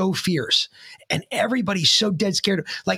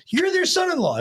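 high, incredulous imitated reaction; astonished; 14 semitones higher than usual. Teller voicing the man learning about the in-laws, astonished. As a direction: pitch leaping up in mock astonishment. Retelling the man's astonished realisation.

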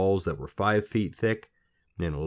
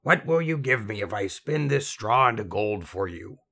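croaky little man; 4 semitones higher, 3 dB louder than usual. A narrator's reading. Strange little man scolding the crying girl, croaky voice. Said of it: "croaky voice".

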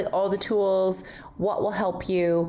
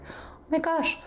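surprised; 7 semitones higher than usual. Real, not acted. Sudden realization time passed.

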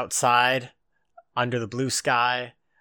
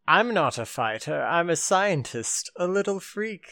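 mock scientist voice; 6 semitones higher than usual. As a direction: pitch climbing higher and higher into a put-on freak voice. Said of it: "who is just like".

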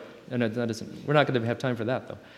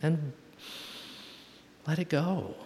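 soft calm advice; 3 semitones higher, 10 dB quieter, slower than usual. Retold or imitated. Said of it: "And Hope says".

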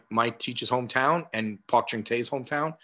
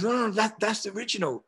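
high-pitched imitation of a Korean-accented curator; 10 semitones higher, faster than usual. Curator insisting to her visitor it's the original, Korean accent. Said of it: "in her Korean accent".

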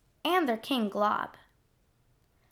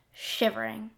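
plain reading voice

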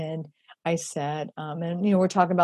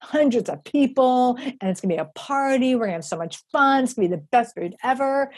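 higher and louder, gushing about the party; big excited dreaming; 7 semitones higher, 5 dB louder than usual. Retold or imitated. Picturing a huge soup kitchen party, gushing higher and louder. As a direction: let the grand dream run away with you, higher and louder. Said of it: "I just go way big in my thinking".

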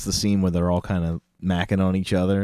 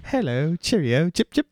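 mock British; 9 semitones higher than usual. Mocking the twin's British accent.

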